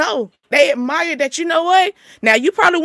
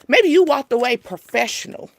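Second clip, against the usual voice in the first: normal talking voice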